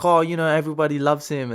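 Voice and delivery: reassuring, silky officer voice, lightly done; Gert impression. Gert reassuring the officer about Schindler, light impression. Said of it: "exactly how, um, Christopher Waltz does it".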